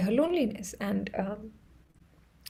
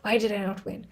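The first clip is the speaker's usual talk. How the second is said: inner grumble; 3 dB quieter than usual. Retold or imitated. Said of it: "on the inside, I'm just like".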